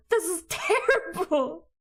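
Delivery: high, loud, slow, laughing through it; real laughing exasperation; laughing rant. Real, not acted. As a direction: crack up mid-complaint. Ranting, then cracks up.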